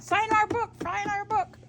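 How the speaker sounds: high, frantic retold plea; freaking-out fan. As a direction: imitate an overexcited fan begging. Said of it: "She was freaking out".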